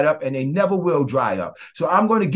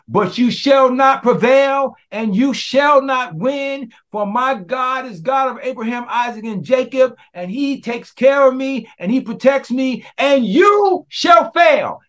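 loud proclamation; 10 semitones higher, 3 dB louder than usual. Shown as a demonstration. Preacher showing the congregation how to cry out, loud defiant proclamation. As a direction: proclaim it at the enemy at the top of your lungs. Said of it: "You say it loud enough".

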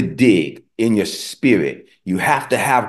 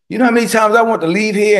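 high, fast, louder complaint; exasperated; 10 semitones higher, 4 dB louder, faster than usual. Retold or imitated. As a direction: vent the frustration quickly. Retold exasperated aside to Jesus.

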